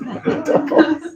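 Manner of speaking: a sudden high laugh breaks out; high burst of laughter. Real, not acted. Laughing high while telling the friends the story.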